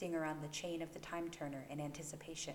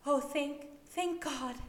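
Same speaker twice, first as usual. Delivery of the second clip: high, louder gasping relief; relieved desperate woman; 9 semitones higher, 5 dB louder, slower than usual. A narrator's reading. Dying mother thanks the stranger, high and breathless. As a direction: cry out thanks to your savior.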